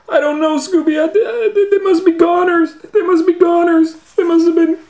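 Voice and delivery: high, loud, laughing in fear; high shaky-laughing fright; terrified Shaggy. Acted. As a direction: squeal it out in panic. Shaggy despairing in story.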